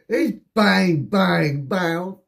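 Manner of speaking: loud, high punched bangs; mimicked deafening gunfire. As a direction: bang it out high, then curse slowly. Acting out deafening shots indoors.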